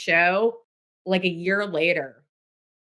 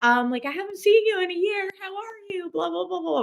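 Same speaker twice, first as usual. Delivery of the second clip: much higher, breezy chit-chat voice; mock small talk; 12 semitones higher than usual. Friend parodying catching up over cigarettes, high and breezy. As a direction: rattle off catch-up chatter in a high lilt. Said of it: "being like".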